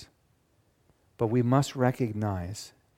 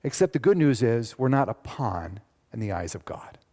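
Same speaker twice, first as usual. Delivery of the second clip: normal talking voice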